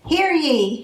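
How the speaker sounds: high, ringing hear ye of a herald; herald's cry. Acted. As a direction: call "Hear ye" out like a town crier. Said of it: "the elves cried".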